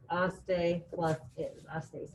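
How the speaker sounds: "Aste plus is" drawn out very slowly; slow rule drill; slow emphasis. Shown as a demonstration. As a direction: stretch each part of "Aste plus is". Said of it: "teacher repeats the rule".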